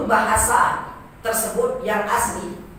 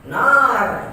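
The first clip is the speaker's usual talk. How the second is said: NAR long, raised and loud; high intonation; 3 semitones higher, 6 dB louder than usual. Shown as a demonstration. Teacher showing the long vowel goes high, raised "Nar". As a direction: push "Nar" up and hold it. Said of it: "tinggi kan intonasinya".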